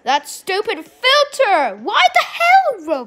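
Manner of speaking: high, loud, drawn-out complaint; frustrated shout. Real, not acted. Player cursing the chat filter, frustrated slow shout. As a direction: rail slowly and loudly at the game.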